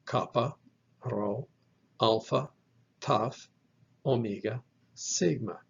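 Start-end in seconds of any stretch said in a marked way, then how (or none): none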